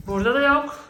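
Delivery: ordinary delivery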